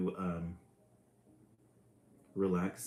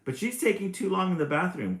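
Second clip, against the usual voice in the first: a higher, whiny mock complaint; complaining; 10 semitones higher, 5 dB louder than usual. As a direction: whine it to Papa. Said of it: "complained brother".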